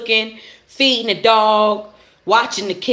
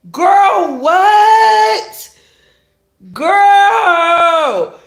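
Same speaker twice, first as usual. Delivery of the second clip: high, drawn-out shocked holler; loud disbelief; 10 semitones higher, 7 dB louder, much slower than usual. Acted. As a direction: cry out, stretching each word in disbelief. Reacting in disbelief to the show.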